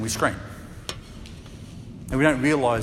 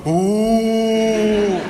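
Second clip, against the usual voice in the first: mock scared yelp; 7 semitones higher than usual. Preacher imagining himself on the boat, mock scared yelp. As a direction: play yourself scared, then laugh. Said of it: "I would have probably gone".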